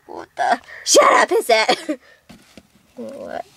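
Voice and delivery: usual speaking voice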